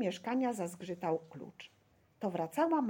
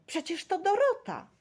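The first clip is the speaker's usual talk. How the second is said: high, loud cry of recognition; excited shout; 12 semitones higher, 6 dB louder than usual. A narrator's reading. Boy recognises Dorota in window, shouting. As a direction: shout out her name in delight. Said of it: "krzyknął Staszek".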